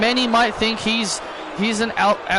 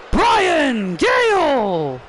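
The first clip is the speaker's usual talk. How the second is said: ring announcer name call; 5 semitones higher, much slower than usual. Acted. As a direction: hold every sound of the name for the crowd. Ring announcer names wrestler.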